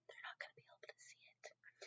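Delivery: whisper. Acted. Whispered aside to children.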